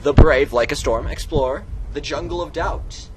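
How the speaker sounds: loud, booming title read; grand title announcement. Acted. Vlogger announces the story's title, booming and grand.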